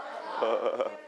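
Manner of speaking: short, low, soft chuckle. Real, not acted. Teacher after asking students to flip it, low chuckle. Laughs at students failing the trick.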